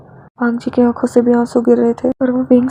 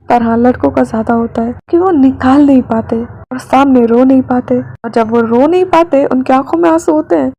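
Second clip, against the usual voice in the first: louder, earnest aside to the listeners; heartfelt aside; 4 dB louder than usual. Acted. Narrator stepping out of the story to share the pain of holding back tears. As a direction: speak straight to the audience with feeling.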